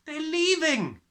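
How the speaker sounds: put-on high, loud panicked child; breathless cry. A narrator's reading. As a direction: blurt it out of breath. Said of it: "she cried".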